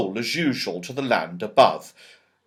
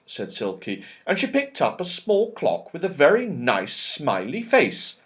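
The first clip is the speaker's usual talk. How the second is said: usual speaking voice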